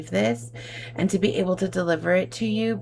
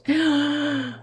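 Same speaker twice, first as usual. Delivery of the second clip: sharp moved intake of breath, higher; emotional gasp; 7 semitones higher than usual. Real, not acted. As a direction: gasp close to tears. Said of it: "I wanna cry".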